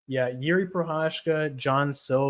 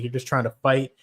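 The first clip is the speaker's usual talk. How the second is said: ordinary delivery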